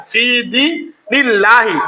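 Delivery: ফি দীনিল্লাহি with the long দী vowel stretched slowly; slow recitation. Shown as a demonstration. Teacher showing elongation on দী. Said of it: "দি মধ্যে তবাই".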